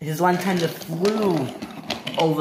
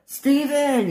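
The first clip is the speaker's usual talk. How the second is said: high-pitched calling shout; 11 semitones higher, 4 dB louder than usual. Acted. Topham Hatt calling Stephen from the water, shouting. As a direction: call his name across the water, high. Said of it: "he shouted".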